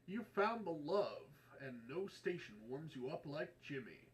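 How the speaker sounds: loud, happy, nostalgic oldies DJ; radio announcer. Acted. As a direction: beam it out like a golden oldies host. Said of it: "announcer style, satisfied, happy, nostalgic".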